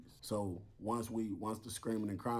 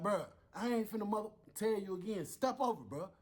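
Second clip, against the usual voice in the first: impatient order; 11 semitones higher than usual. Retold or imitated. Lieutenant losing patience.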